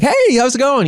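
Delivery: chirpy, smiley mock greeting; nice smiley routine. Stranger tricking him with a greeting, smiley friendly. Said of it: "nice smiley routine".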